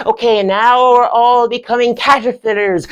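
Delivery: high, loud drama lecturer; Stevie. Acted. Lecturer turning class into caterpillars, high and theatrical. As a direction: announce it grandly to class.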